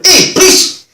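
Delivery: high, loud shout down a corridor; acted angry yell; angry, hostile. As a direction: bellow for attention aggressively. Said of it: "very angrily and quite hostile".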